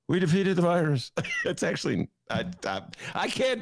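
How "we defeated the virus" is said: a light, half-hearted Biden voice, barely changed; mock the president's line in a thin take on his voice; Joe Biden imitation. Host doing a self-doubting Biden impression, lightly voiced. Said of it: "here's my Joe Biden imitation".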